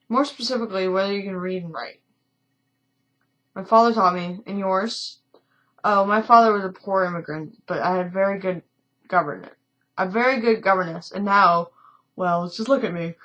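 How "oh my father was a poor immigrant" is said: usual speaking voice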